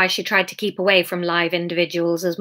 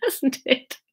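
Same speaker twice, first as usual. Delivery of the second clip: plain reading voice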